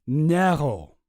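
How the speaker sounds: "Narrow" with a German accent. Shown as a demonstration. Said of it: "He's got a German accent".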